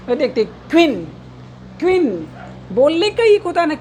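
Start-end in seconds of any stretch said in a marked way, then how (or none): 0.7-2.3 क्वीन said as one syllable, "kveen"; model pronunciation. Shown as a demonstration. Teacher shows students how to pronounce the word, model pronunciation. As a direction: say क्वीन clearly as "kveen", pausing between repeats. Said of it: "इस तरीके से हम लोग pronunciation करेंगे".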